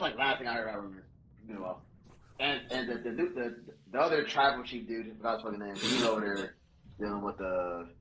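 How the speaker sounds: ordinary talk, nothing marked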